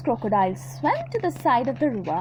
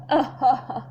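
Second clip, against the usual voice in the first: evil croc chuckle. Acted. Crocodile laughing at the monkey, villainous.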